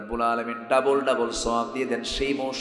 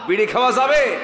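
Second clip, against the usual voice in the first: shouted question; 8 semitones higher, 8 dB louder than usual. Real, not acted. Preacher shouting question at crowd.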